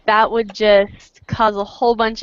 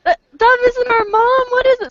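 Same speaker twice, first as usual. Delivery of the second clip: high squeaky kitten protest; kid voice; 14 semitones higher, 4 dB louder, slower than usual. Retold or imitated. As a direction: shout it like a betrayed little kid. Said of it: "Feather are gonna be like".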